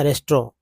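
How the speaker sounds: "रेस्ट्रो" in two syllables, res-tro; variant pronunciation. Shown as a demonstration. Teacher showing the short variant, "रेस्ट्रो" as res-tro. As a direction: end "रेस्ट्रो" on an open o. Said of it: "रेस्ट्रो बोलते हैं".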